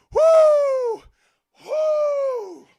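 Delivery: high whooping cheer. Real, not acted. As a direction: let out a big high whoop. Whooping after dancing.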